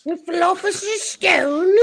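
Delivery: very high, drawn-up squeal of a voice; mock title voice. Co-host mimicking the disputed book title, mocking.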